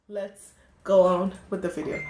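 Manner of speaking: ordinary talk, nothing marked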